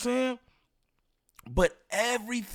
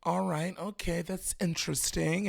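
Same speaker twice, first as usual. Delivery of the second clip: mocking her soft interview voice; 3 semitones lower, 10 dB quieter than usual. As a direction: imitate a prim polite interviewer, lightly. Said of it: "She's sitting up there".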